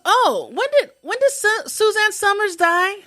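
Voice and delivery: high, loud imitation of an oblivious woman; loud blurted question. Therapy patient interrupting everyone, loud imitated blurt. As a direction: pipe up high and loud across the room.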